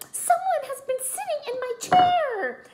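very high, whiny complaint; complaining Mama Bear. A narrator's reading. Mama Bear complains that someone sat in her chair. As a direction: whine it out, aggrieved. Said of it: "Mama Bear complained".

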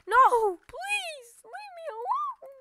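high, loud squealing plea, hammed up; mock bullied victim. Streamer pretending enemies bully him, hammed-up high pleading. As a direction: beg the bully to stop like a cartoon victim.

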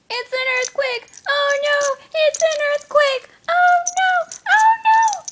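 high, loud squealing alarm; hammed-up shriek of pretend terror; mock panic. Owner playing earthquake with her dog, mock panicked shrieking.

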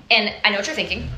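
normal talking voice

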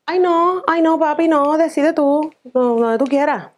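high, slow coy whine; playing hard to please; high drawn-out coyness, leaving it to him; coy indecisive girlfriend.

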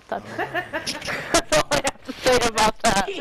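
slow words through laughter; laughing. Real, not acted. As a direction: laugh while talking.

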